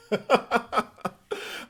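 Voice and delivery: airy burst of laughter, pitched high; breathless giggle; breathy laugh. Real, not acted. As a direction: laugh off the chat comment. Laughing at chat's accent comment.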